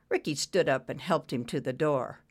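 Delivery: usual speaking voice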